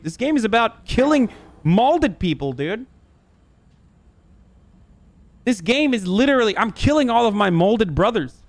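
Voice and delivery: high-pitched, hammed-up dismay; exaggerated indignant disbelief; mock outrage. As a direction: protest in a high, swooping voice. Mock outrage at the game's premise.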